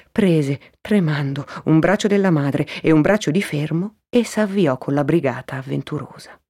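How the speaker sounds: plain reading voice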